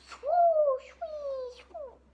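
whoosh the toy through the air; swoosh sound effect. Pretend. Boy giving his toy a flying noise, high swooshing. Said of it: "he's gonna be like".

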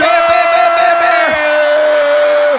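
rowdy jeering pub banter. Real, not acted. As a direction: pound out the chant. Chanting cheer.

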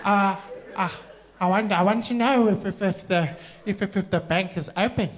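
stuttering, hesitant lady; faltering, polite enquiry. Acted. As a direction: keep tripping over the first words.

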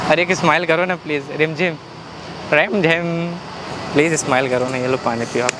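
low, soothing plea; gentle coaxing. Real, not acted. Prankster offering water to the upset fan, gently coaxing. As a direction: coax a smile out of someone upset.

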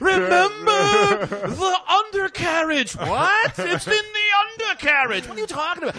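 put-on high, slow, strained old man's secret; croaking dying man. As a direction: whisper a last secret, high and drawn out. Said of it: "he goes".

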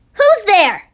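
loud, startled challenge; sharp demand. Acted. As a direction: call out at the hidden listener. Caught eavesdropper, sharp challenge.

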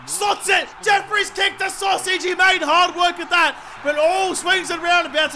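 high, loud celebratory commentary; real jubilant outburst; excited goal call. Real, not acted. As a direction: call the goal like a thrilled fan. Jeffrey kicks a goal.

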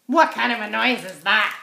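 loud, fast crow question; crow's indignant question; scoffing crow. A narrator's reading. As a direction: squawk it out dismissively.

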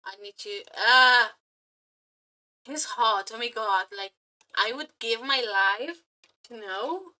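normal talking voice

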